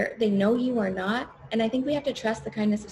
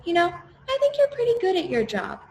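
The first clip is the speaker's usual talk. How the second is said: much higher, light voice of a kind stranger; kind bystander; 8 semitones higher than usual. Retold or imitated. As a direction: lift her up with a gentle compliment. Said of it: "one of the guys standing right watching the event goes".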